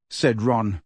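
usual speaking voice

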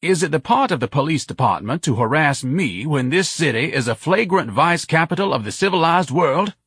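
high, pompous bellow; indignant bellow. A narrator's reading. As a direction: bellow your outrage over a crowd. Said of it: "Ignatius bellowed over the crowd".

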